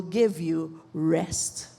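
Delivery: very slow, low "give you rest"; soothing assurance. Real, not acted. Preacher promising rest to tired mothers, soothing. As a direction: let it sink low and settle.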